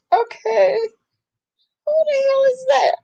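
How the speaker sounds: high, half-laughing outburst of disbelief; shocked disbelief. Retold or imitated. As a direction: react like you can't believe who just walked in. Shocked at stranger's deep voice.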